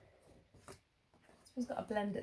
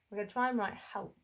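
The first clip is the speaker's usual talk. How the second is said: usual speaking voice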